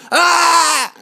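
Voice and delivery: high loud shriek of fear; panicked scream. Acted. Another islander screams running past. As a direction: scream and run.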